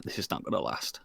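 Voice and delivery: inner thought whisper. Retold or imitated. Remembering a private doubt about the game, whispered. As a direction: breathe it as a secret thought. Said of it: "I'm like".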